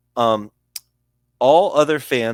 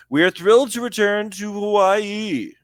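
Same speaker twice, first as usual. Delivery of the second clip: high, loud mock executive voice; hammed-up pitch-raised team president; Kevin Demoff impression; 10 semitones higher, 4 dB louder than usual. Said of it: "I guess Kevin Demoff doesn't sound like that".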